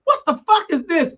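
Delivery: sudden high, loud outburst. Retold or imitated. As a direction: blurt it like a startled elder stopping the room.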